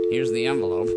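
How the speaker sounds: usual speaking voice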